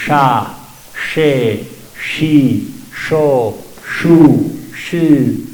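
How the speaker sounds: sha, she, shi, sho, shu, shi with tongue back, lips relaxed; Chibcha sh syllables. Shown as a demonstration. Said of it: "la clave es la lengua, no los labios".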